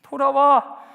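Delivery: God calling out come back; loud plea. Acted. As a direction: call it out firmly. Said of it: "loud plea".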